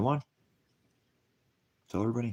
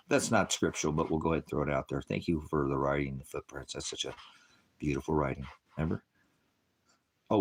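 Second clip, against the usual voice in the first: normal talking voice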